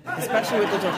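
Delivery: laughing. Real, not acted. Host echoes guest laughing.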